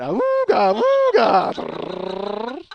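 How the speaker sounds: high "awooga" horn, long drawn-out second blast; imitated horn. Rider answering a doubter with a horn, comic honk. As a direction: honk it like an old car horn. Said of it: "looked at him and went".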